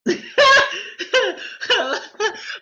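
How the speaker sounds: high, loud helpless laugh; burst of laughter. Real, not acted. Preacher cracking up at her own joke to viewers, loud high laughter.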